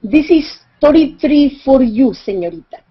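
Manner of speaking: put-on loud, thick Spanish-accented salesman. Pottery seller quoting a price to a tourist, thick Spanish accent. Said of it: "in a very thick accent".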